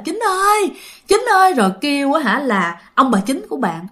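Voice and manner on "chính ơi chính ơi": imitating a great-grandfather calling from sleep; old man calling out in his sleep. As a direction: cry out for your son while dreaming. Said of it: "ông cố cứ kêu á là".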